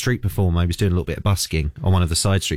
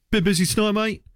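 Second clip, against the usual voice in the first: much higher, breezy small-talk voice; chatty taxi passenger; 13 semitones higher than usual. Retold or imitated. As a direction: make friendly chit-chat with the driver. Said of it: "you go".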